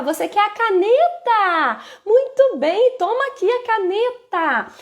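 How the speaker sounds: voz aguda e animada de incentivo infantil; ofereça cantadinho, bem agudo, como a uma criança; fala com criança. Shown as a demonstration. Modela fala para a criança. (voz aguda de quem brinca com criança)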